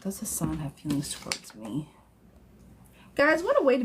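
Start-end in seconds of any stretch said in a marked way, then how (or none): 0.0-1.9 much softer, half to herself; murmured. Real, not acted. Tarot reader murmuring a viewer's question over the deck.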